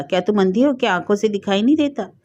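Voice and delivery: ordinary delivery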